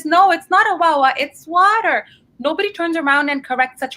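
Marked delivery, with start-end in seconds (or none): 0.0-2.0 mock correction. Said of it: "nobody turns around and says".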